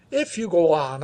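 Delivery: ordinary delivery, nothing marked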